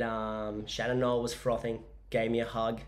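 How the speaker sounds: ordinary talk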